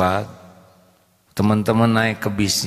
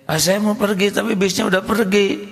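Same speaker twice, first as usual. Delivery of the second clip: put-on child voice; 10 semitones higher than usual. The boy answers the mother.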